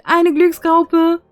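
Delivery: high, slow cute voice. Real, not acted. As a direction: say it sweet and high. High cute self-intro.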